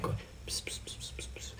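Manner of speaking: tiny whispered psst sounds; unvoiced "pss pss" whispered in a string; imitated mic whispering. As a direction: mimic ASMR whispering with soft hissy syllables. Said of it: "people whispering into their mic".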